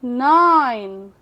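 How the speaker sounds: Nine stretched very slowly, low; slow demo. Shown as a demonstration. Teacher to students, slow nine. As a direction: drag nine out, long आई. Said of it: "long sound से बोलेंगे".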